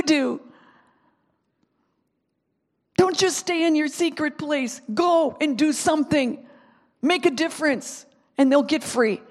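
high, loud, urgent exhortation; impassioned preaching. Real, not acted. Preacher urging people to go as Jesus did, full-force preaching. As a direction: preach it at full force, driving each phrase.

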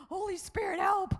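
high, loud plea to the Holy Spirit; desperate cry for help. Retold or imitated. Runner crying out to the Holy Spirit, loud plea. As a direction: call out for help mid-run, gasping. Desperate prayer while running, retold.

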